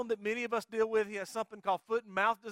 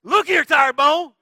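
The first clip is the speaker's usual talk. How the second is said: with conviction; 6 semitones higher, 13 dB louder, slower than usual. Shown as a demonstration. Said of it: "you gotta say it with conviction".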